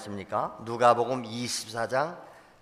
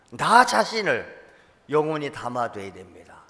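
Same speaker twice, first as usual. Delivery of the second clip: ordinary talk, nothing marked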